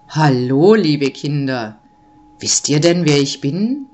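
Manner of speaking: deep, loud Nikolaus greeting; dark big-man voice. A narrator's reading. Nikolaus greets the children, deep and booming. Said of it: "fragte die dunkle Stimme des großen Mannes".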